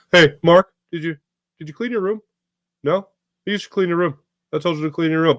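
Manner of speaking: mock big brother scolding; uncle bit voice. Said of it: "That's how my brother and I do a bit".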